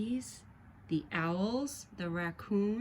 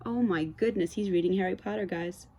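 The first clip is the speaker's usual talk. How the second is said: usual speaking voice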